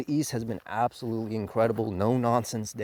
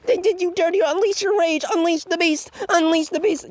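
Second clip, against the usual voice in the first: high, loud whiny mockery of a villain; mock hissy fit; 5 dB louder than usual. Mocking the villain's hissy fit, high whiny ranting. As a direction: ham up a tantrum, much higher and louder. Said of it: "a, you know, a hissy fit".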